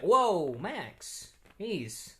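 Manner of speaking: mock-startled yelp, high and swooping. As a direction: act out being jolted by a sudden scare. Acted quote of being startled by Max.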